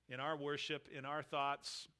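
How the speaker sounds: normal talking voice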